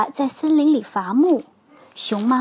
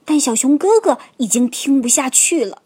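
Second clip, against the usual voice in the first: ordinary delivery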